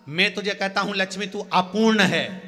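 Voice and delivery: high, loud, indignant scolding; angry rebuke. Acted. Vishnu angrily rebuking his boastful wife, loud and high. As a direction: rebuke her with mounting force and pitch. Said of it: "भगवान विष्णु को क्रोध आ गया".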